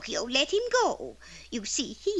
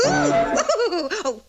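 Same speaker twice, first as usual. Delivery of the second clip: high, loud tittering laugh as she appears; a fairy's bubbly laugh; giggling fairy; 6 semitones higher, 5 dB louder than usual. Acted. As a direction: giggle your way into the scene.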